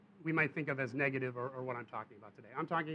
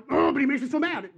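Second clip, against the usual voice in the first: put-on high, loud childish whine; a kid protesting to his mom about his brother; whiny kid complaint; 10 semitones higher, 9 dB louder than usual. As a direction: whine it like a mad little boy. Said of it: "that's how a child thinks".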